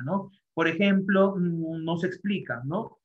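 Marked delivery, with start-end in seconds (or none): none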